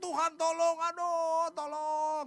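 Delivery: high, loud wail with drawn-out words; hammed-up desperate plea to God; crying out. Israelites begging God for help, acted wailing. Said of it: "Lalu mereka teriak".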